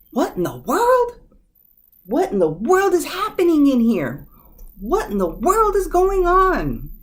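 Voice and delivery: high, loud, stretched-out "what in the world"; mother storming in on fighting kids; scolding mother. Retold or imitated. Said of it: "the things my mother would always say".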